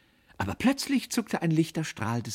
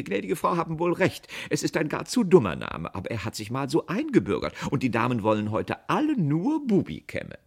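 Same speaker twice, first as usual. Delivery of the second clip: higher, louder, lightly voiced saleswoman; concede, then press on in raised voice; placating saleswoman; 9 semitones higher, 5 dB louder than usual. A narrator's reading. Saleswoman appeasing an indignant customer, high-voiced.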